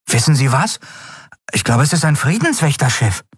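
put-on high-pitched boy sharing a guess; boy character. Boy telling the man his guess, boyish. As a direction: speak up as a young lad.

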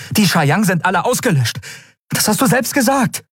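hoch und vorwurfsvoll; aufgebrachter Ausbruch. A narrator's reading. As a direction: fahr den Gefangenen empört an. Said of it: "platzte es aus ihr heraus". (aufgebracht herausgeplatzt)

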